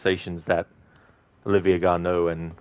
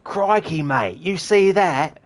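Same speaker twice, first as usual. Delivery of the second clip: put-on high, loud Australian bloke accent; a broad Australian bystander, slow and amazed; Australian NPC gawking at something, high and loud; 10 semitones higher, 5 dB louder, much slower than usual. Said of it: "doing some N P C voices".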